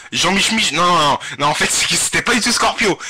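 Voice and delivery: ordinary talk, nothing marked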